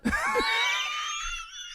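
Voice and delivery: long high-pitched squealing laugh; helpless laughter. Real, not acted. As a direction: crack up completely, high and breathless. Cracks up mid-story.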